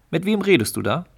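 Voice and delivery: jealous sparrow demanding who she's talking to; suspicious husband. Acted. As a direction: call out to catch her talking. Jealous husband calls from nest.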